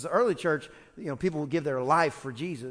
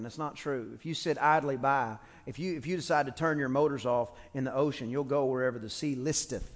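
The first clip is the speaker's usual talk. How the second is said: ordinary talk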